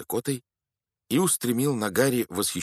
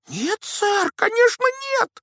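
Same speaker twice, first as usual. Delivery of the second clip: very high, loud elf cry; exclaiming elf; 8 dB louder than usual. A narrator's reading. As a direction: cry it out alarmed. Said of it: "воскликнул эльф".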